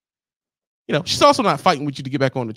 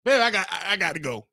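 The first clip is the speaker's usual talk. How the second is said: mock driver; 6 semitones higher than usual. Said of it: "So you say".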